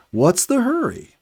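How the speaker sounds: high, unhurried squirrel voice; lazy squirrel. A narrator's reading. Lazy squirrel on the tree teasing the narrator, high and unhurried. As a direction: let the squirrel ask it without a care. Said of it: "as if to say".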